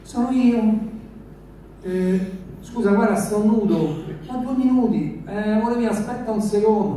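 lightly voiced coaxing girl; girl's voice.

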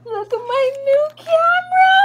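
high, loud squealing delight; very high excited squeal. Real, not acted. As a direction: squeal with pure joy at the gadget. Squealing over new camera again.

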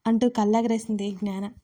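ordinary delivery

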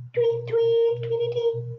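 high chirpy tweets; bird tweets. Shown as a demonstration. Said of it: "What kind of sounds do birds make?".

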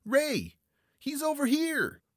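very high, loud scream for help. A narrator's reading. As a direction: scream out to your partner, drawing out the words. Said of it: "Then he screamed".

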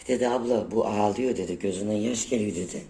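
ordinary delivery

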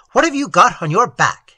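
loud, suspicious sentry demand; loud challenge. A narrator's reading. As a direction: call out the question with suspicion.

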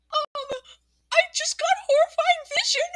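high-pitched worried character. Acted. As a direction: pitch it way up, anxious to help.

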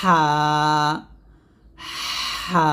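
long rush of air, then pressed হা; চিকন হা. Shown as a demonstration. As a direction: push breath from mid-throat into হা. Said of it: "তারপর চাপ দিয়ে উচ্চারণ করবেন". (আগে বাতাস, তারপর চাপ দিয়ে হা)

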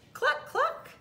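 very high, loud clucks; chicken cluck. A narrator's reading. Chicken answering Duck's hello, high loud clucking. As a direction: cluck the words like a hen. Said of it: "said Chicken".

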